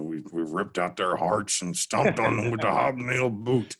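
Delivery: a hint of an old-time radio play-by-play call, lightly voiced; Larry Munson call. Guest quoting a legendary Georgia radio call, lightly imitated. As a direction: echo the famous radio call with a touch of its gusto. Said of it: "and, and Larry Munson".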